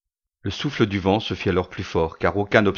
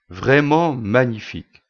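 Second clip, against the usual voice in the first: slow, emphatic, higher-pitched admiration; exclaiming admiration; 4 semitones higher, 4 dB louder, slower than usual. Acted. Customer marvelling at his order in the shop, exclaiming. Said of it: "s'exclama-il".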